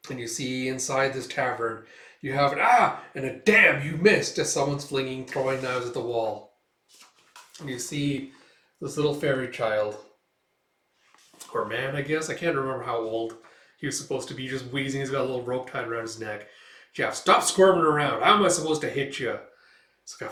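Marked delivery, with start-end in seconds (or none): 2.6-3.0 higher, louder sharp yelp; startled yelp. Acted. Tavern man startled. 3.4-4.4 loud, slow jeering call; mocking yell. Thug taunts knife thrower. 16.9-19.4 louder, fast rough scolding; thug scolding. Acted. Thug scolds tied elf.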